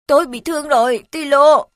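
loud, hurt cry for the dog; pained cry. Acted. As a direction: cry out wounded and scared. Wounded boy calls the dog. (tiếng kêu đau đớn)

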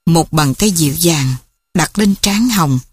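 usual speaking voice

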